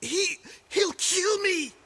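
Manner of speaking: fearful stammer. Acted. Frightened of his master.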